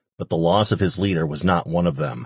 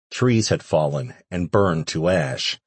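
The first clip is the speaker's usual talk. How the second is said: ordinary delivery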